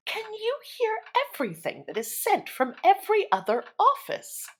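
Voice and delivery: put-on high prying old lady; nosy busybody voice. A narrator's reading. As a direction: ask wide-eyed like a curious old lady. Nosy woman asks in wonder.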